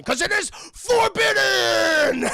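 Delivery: drawn-out, high-pitched furious bellow; hammed-up roar, stretching the last word; roaring Beast. Beast roars the famous line.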